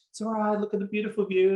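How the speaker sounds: put-on parent's high soothing voice to upset kids. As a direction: reassure a crying child, brightly. Soothing screaming toddler on stuck wheel.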